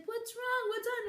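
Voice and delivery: high mock worried mom; imitating her worried mom, high; mom asking. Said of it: "my mom was like".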